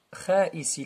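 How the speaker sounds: ordinary talk, nothing marked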